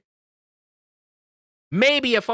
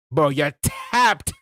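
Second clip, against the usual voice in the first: slow drawn-out try; 5 semitones lower, slower than usual. Pretend. Trying out new slang.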